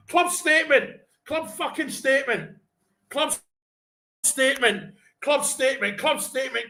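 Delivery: high, breathless-with-news repetition; excited announcing. Real, not acted. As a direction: proclaim it over and over, elated. Announcing breaking news, still celebrating.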